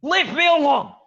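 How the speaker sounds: high, loud cries to fend off attackers; beleaguered fire dwarf. Acted. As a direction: shout them away, high-pitched and frantic. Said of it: "And he is going".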